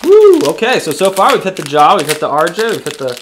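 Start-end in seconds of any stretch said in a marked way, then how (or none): 0.0-0.4 high, loud excited whoop. Real, not acted. Card breaker whooping at a big pull, loud and high.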